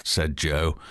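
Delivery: ordinary talk, nothing marked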